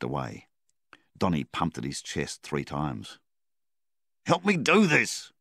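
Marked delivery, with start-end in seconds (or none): 4.3-5.3 high, loud urgent call; yell. A narrator's reading. Donnie yelling at Flynn to help, loud high call. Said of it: "he yelled at Flynn".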